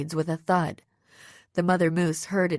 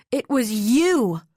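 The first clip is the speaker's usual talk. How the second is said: slow, higher, louder stunned realization; dawning realization; 6 semitones higher, 5 dB louder, slower than usual. A narrator's reading. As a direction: let the truth hit you slowly. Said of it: "as realization dawned".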